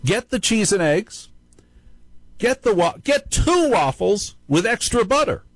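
high, mock-tempting Satan voice; Satan's loud whisper. Host mimics Satan tempting him at the Waffle House, high mock voice. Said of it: "That's what Satan whispers to me".

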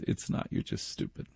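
under-the-breath put-down; muttered aside. Real, not acted. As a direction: drop to a dry mutter. Muttered aside insulting them.

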